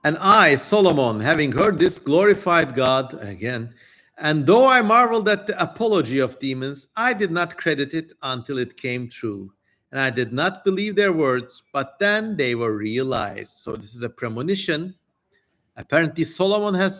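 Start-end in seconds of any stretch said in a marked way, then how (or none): 0.1-3.1 raised, declaiming scripture voice; solemn reading. Acted. Streamer reading the demon's prophecy to chat, solemn declaiming. Reads Solomon's testament aloud. 4.2-13.6 raised, declaiming scripture voice; solemn reading. Acted. Streamer reading the demon's prophecy to chat, solemn declaiming. Reads Solomon's testament aloud.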